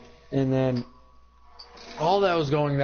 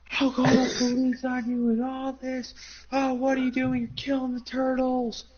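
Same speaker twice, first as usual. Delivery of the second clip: put-on cranky old lady; 10 semitones higher than usual. Old woman on porch scolds boys for killing turtles, high shrill nag. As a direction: nag like an outraged old neighbour. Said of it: "He's like".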